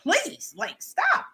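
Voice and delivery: high-pitched pleading brush-off; high exasperated voice. Retold or imitated. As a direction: squeal it like an annoyed teen. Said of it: "So I'm like".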